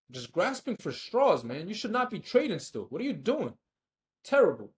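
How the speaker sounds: emphatic telling-off ending on a loud verdict; scolding himself. Real, not acted. Scolding himself over his trade.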